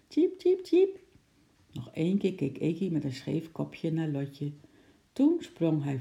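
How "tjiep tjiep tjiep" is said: very high little cheeps; chirping squirrel. A narrator's reading. Squirrel's goodbye to the girl, high chirping. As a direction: chirp high like a small animal.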